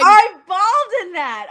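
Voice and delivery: loud, high, emphatic outburst; emotional gushing. Real, not acted. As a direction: blurt it out loud, overcome by the memory. Gushing about tearful episode.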